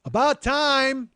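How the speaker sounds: drawn-out, high, loud "About time!"; impatient yell. Retold or imitated. Customer yelling at the late delivery guy, impatient yell. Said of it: "somebody yell".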